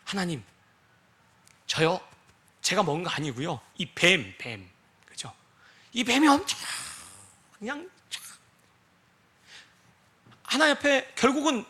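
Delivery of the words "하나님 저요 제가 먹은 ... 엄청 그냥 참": Eve's excuse. Retold or imitated. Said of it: "하와에게 하나님도 묻습니다".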